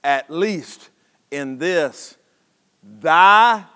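slow, measured reading with long pauses; solemn scripture reading. Real, not acted. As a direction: let each phrase of the verse stand alone. Reads scripture aloud.

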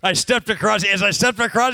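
ordinary talk

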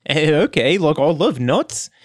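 easygoing, reassuring character voice; lizardfolk Reese. Acted. Reese reassures the kobolds, easygoing character accent. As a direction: casually talk the strangers round.

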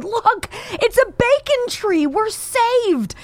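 high, slow cry of joy; excited cowboy shout. Acted. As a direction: yell it like you've found rescue. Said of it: "He shouts to his companion".

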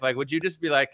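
ordinary delivery

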